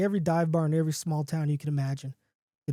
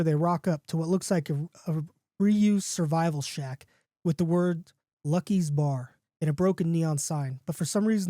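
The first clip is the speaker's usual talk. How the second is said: usual speaking voice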